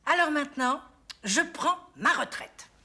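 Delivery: loud, high, slowing on RETRAITE; defiant proclamation with measured words; emphatic declaration. Acted. Mother declares she quits mothering.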